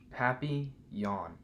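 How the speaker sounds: papillon said slowly as pah-pee-YOHN, French style; French pronunciation. Shown as a demonstration. Teaching a reader to say papillon slowly in French. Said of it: "You say it like this".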